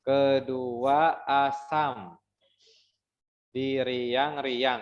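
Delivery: "Kedua asam" very slow, syllables apart; counting syllables. Shown as a demonstration. Teacher recounting the second line. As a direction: draw out "riang-riang" beat by beat. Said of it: "kita hitung ulang".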